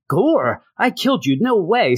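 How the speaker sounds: high-pitched retold shock; mock disbelief. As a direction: play stunned, can't believe who's back. Acting out Thor's disbelief at Gore.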